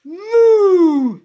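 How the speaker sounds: huge, high, long moo; full-volume high-pitched mooing yell; shouted moo. A narrator's reading. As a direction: blast it out to startle a bird. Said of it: "he shouted".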